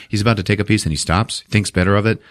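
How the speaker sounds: normal talking voice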